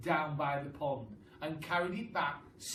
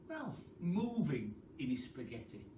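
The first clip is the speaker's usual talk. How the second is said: ordinary delivery, nothing marked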